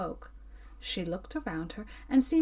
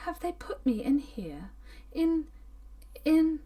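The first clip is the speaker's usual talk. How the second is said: terrified whisper; 6 semitones higher than usual. A narrator's reading. Terrified woman realising she is confined.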